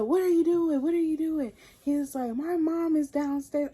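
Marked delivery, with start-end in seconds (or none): 0.0-1.5 high-pitched mock yell without the volume. Boyfriend reacting to a caught fist, retold as high mock yell. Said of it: "he's yelling". 2.4-3.7 high, restrained mock yell. Boyfriend warning that his mom is downstairs, held-back mock yell. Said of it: "he's yelling".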